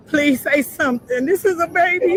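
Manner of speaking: plead, rising and cracking with fear; desperate pleading. Real, not acted. Grieving relative begs the police for news, panicked high pleading. Mother pleads while awaiting identification.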